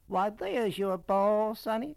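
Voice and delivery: exaggerated plantation-lady Southern accent; increasingly Southern lady. A narrator's reading. Lady pointing out the boy's lost ball, thick Southern accent. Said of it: "her voice becoming more southern".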